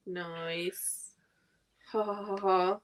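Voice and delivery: ordinary talk, nothing marked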